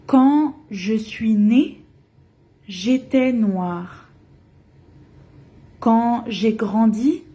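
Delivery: poem recitation. Acted. Teacher reading Senghor's poem aloud to learners, measured recitation. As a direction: recite each line and let it land.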